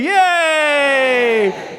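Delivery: high, loud, gleeful yay, last words drawn right out; excited cheer. Real, not acted. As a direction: sell it to the crowd with a big cheer. Host celebrating a made-up word.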